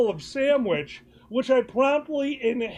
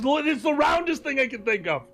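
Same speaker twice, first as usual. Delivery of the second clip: defensive repeat; 3 semitones higher than usual. Real, not acted. Repeats it, defending his drawing.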